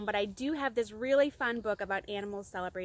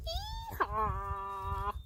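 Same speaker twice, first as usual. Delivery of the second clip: donkey bray; 2 semitones lower, 4 dB quieter than usual. Acted. Little bird trying its song, drawn-out hee-haw. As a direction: bray it out slowly. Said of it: "The bird chirped".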